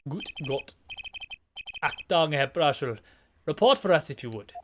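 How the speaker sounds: lightly voiced bad German accent. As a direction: play the fake German prosecutor. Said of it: "having a bad German accent".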